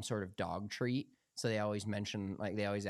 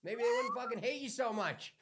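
mocking cashier voice; 13 semitones higher than usual. Cashier teasing the customer through a coworker, high mocking voice. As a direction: taunt the customer in a high, hammed-up voice. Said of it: "She goes".

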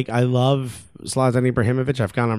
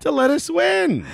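mock entitled players; slower than usual. Mocking PSG players to opponents.

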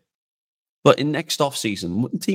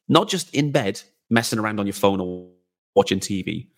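ordinary talk, nothing marked

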